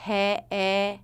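"He, eh" as two held open eh sounds; slow "heh, eh" without the closing glide; open first half. Shown as a demonstration. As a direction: isolate the jaw-dropped "eh" of "hey". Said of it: "drop your jaw enough for the first half".

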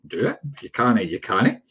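usual speaking voice

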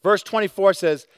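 usual speaking voice

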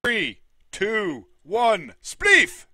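high countdown ending in a shout; announcer countdown. Real, not acted.

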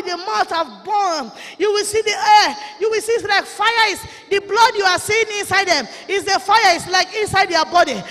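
ordinary delivery

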